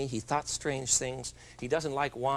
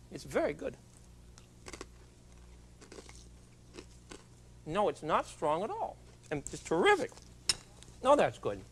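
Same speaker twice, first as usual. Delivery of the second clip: talking while chewing; words round a mouthful of garlic; mouth full; 7 dB quieter than usual. Real, not acted. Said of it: "I don't mind talking with my mouth full".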